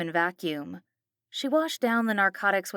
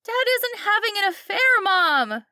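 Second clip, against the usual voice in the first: put-on high, loud pleading girl; Kelly crying out; 14 semitones higher, 6 dB louder than usual. A narrator's reading. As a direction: defend her dad, desperate. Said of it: "Kelly cried".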